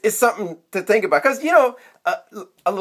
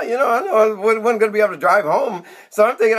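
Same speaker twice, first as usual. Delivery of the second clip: ordinary talk, nothing marked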